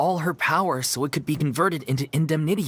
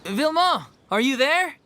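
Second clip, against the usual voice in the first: much higher, carrying call; 10 semitones higher than usual. Acted. Searching for Vilma, calling out high and carrying. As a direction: call out to someone far off.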